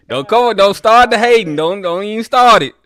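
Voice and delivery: high, loud mock warning; playful protest. Real, not acted. Teasing warning to caller about hating.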